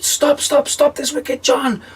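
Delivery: very high squealed stop; devil shouting. Acted. Devil in the rocking chair, squealing high. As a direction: squeal slowly, begging. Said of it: "the devil shouted out".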